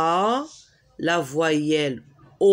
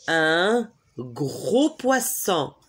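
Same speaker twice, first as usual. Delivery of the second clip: "Un gros poisson" spoken slowly, each word clear; slow model phrase; slower than usual. Shown as a demonstration. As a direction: say it slowly for students to copy. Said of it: "Répétez".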